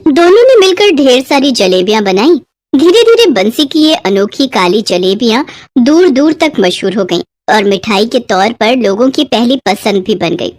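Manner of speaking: usual speaking voice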